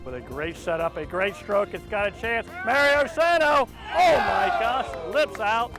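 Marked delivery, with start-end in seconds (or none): 2.6-5.7 loud, high excited shout; excited call. Real, not acted. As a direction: exclaim at the near miss. Live call of near miss.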